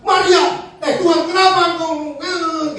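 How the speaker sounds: high-pitched mock complaining as Marta; Marta's complaint. Acting out disappointed Marta complaining to Jesus.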